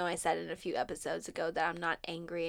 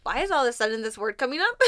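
mock exasperated; 5 semitones higher, 7 dB louder, much faster than usual. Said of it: "And I was like".

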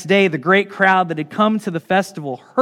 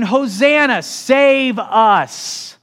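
acted crowd cry, raised and drawn out; high, pleading yell; 5 semitones higher, 3 dB louder, much slower than usual. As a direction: call out for rescue with the crowd. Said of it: "they started yelling".

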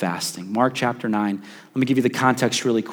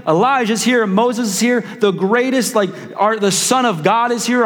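excited Peter; 6 semitones higher than usual. Retold or imitated. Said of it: "Peter's like".

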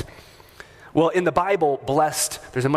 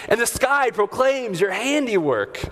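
imitated shouted praise; 10 semitones higher than usual. Buddy shouting scripture on a mountain hike, imitated high-pitched shout. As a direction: call it out like a booming outdoor proclamation, pitched well up. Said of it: "Howard would just start shouting out scriptures and praises".

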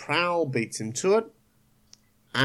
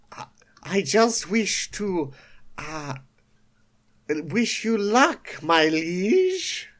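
high, slow, oily flattery; sly fake loyalty; 5 semitones higher, slower than usual. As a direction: simper it out to the boss. Starscream voicing fake loyalty.

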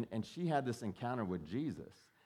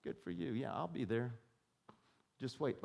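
dismissive; 6 dB quieter than usual. Retold or imitated. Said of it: "they're like".

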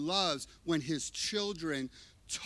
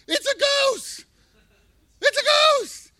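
shrieking, very high and loud panic; high-pitched, screaming terror at a ghost; terrified scream; 12 dB louder, slower than usual. Acted. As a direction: scream it like you've seen a ghost. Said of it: "this is how they cry out to Jesus".

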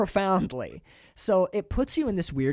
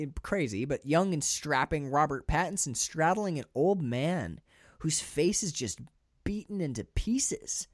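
ordinary delivery

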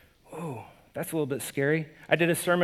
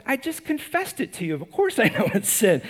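exasperated friend; 5 semitones higher than usual. Retold or imitated. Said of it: "look at him and go".

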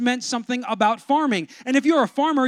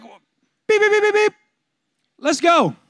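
high loud beeps then let's go; mock beeps and hype; 8 semitones higher, 5 dB louder, slower than usual. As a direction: mimic pushing the button, then hype up. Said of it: "And you're like".

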